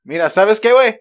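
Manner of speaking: Spanish. Pretend. Trying out some Spanish on a friend, Spanish accent. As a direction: put on your best Spanish. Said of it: "that's the best Spanish I had".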